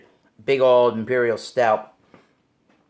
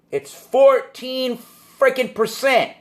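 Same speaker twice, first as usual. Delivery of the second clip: shocked disbelief; 9 semitones higher, slower than usual. Real, not acted. Shocked by beer strength.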